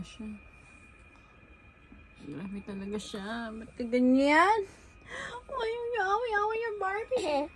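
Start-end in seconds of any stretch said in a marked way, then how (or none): 5.5-7.4 put-on baby talk. Mother voices for baby.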